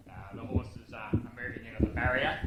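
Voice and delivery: a lightly put-on race-caller drone. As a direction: call it like an old-time race caller settling the field. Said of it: "And I went something like this".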